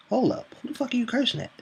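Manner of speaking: high-pitched incredulous clapback; indignant comeback. Retold or imitated. Chat agent answering a cursing customer, indignant.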